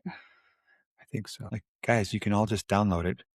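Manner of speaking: quiet aside. Real, not acted. Off-mic aside while paused.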